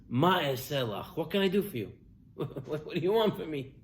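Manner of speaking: softly voiced, mild helplessness; subdued helpless reply. Elisha answering the desperate widow, soft and helpless. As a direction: answer her quietly, at a loss.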